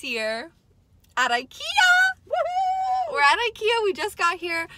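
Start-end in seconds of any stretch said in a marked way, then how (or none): 2.3-4.7 high, bright cheering; excited cheer. Real, not acted. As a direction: cheer like you just arrived. Arriving at ikea, cheering.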